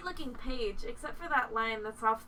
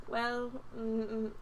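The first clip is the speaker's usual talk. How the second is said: usual speaking voice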